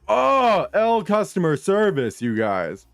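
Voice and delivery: mock-cheery high "Oh" dropping flat; sarcastic jab. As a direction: jeer at the bad service. Mocking the store's service.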